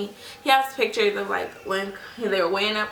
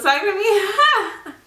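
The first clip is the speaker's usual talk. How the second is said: higher, louder words through laughter; laughing; 12 semitones higher, 7 dB louder, slower than usual. Real, not acted. Mother laughing about dozing off on the nurse. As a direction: giggle it out.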